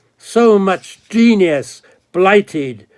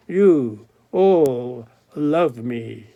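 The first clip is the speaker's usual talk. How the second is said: slow lowered echo; 3 semitones lower, slower than usual. Acted. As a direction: let it sink in slowly. God repeats claim.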